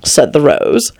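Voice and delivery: plain reading voice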